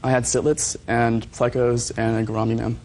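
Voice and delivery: normal talking voice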